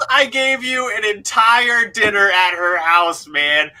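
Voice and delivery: hammed-up indignation; mock exasperated rant. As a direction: scold the audience in jest. Mock scolding chat for wanting more.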